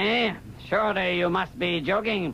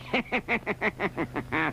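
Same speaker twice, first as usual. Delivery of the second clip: evil chuckle; 4 dB quieter than usual. Acted. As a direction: chuckle at the thought of pain. Caliph relishes torture.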